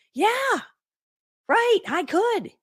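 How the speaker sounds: high, drawn-out comic answer; dramatic realization. Retold or imitated. Wife answering her husband's suggestion, comically dramatic. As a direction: stretch it out high and slow. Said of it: "It was a very dramatic moment".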